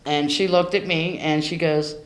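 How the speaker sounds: usual speaking voice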